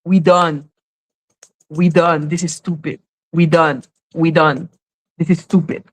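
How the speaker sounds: low-pitched, weary dismissal as the judge; fed-up judge. Retold or imitated. Judge dismissing a stupid case, low-pitched and fed up. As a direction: hand down a curt, low verdict, done with it all. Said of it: "the judge is literally saying".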